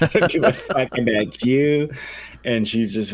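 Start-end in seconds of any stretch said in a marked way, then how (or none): 0.0-1.9 mock defiant wife. Wife's attitude voiced in story.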